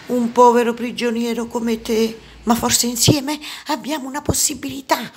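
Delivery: put-on high-pitched old prisoner's voice; old man. A narrator's reading. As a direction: propose an alliance as an old captive. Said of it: "disse il vecchio".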